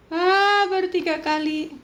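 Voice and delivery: high, loud sarcastic echo; scornful hammed-up repeat; loud mocking scoff. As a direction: throw his excuse back at him. Mocking the husband's excuse.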